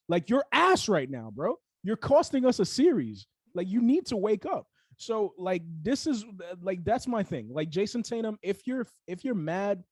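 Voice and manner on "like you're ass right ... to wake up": higher, louder acted telling-off; pretend coach's scolding. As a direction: lay into the player as his fed-up coach. Plays coach scolding his player.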